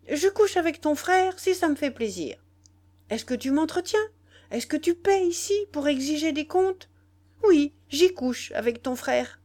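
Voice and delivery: high, loud, exasperated retort; angry impatience. A narrator's reading. Nana defies the jealous boy, impatient and angry. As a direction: throw the challenge back at a pest. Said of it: "reprise d'impatience".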